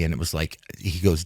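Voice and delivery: ordinary talk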